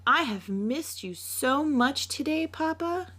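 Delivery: put-on a child running to her father; excited little girl. Little Mina greeting her papa at the door, in a high excited child voice.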